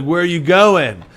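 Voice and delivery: put-on drunk. Drunk comic calling after the woman leaving to smoke, slow and loud. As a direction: play it sloppy and drawn out, like a drunk heckler. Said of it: "he was like, pretty drunk already".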